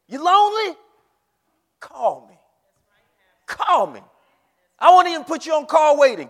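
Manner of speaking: high, loud revival preaching, building; preaching at full force. Acted. Pastor rousing the congregation, full-force preaching. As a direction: rouse the congregation with rising calls.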